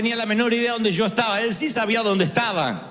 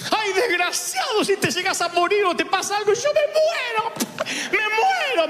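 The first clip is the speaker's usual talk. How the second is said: frantic mother; 12 semitones higher than usual. Retold or imitated. Mother finds her lost son, frantic scolding. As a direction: yell like a panicked mother. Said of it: "la de mi mamá".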